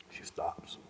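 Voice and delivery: whispered, barely voiced; hushed whisper. Real, not acted. Telling the host how he held grandmother, whispered. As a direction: drop to a breathy whisper as if at her bedside.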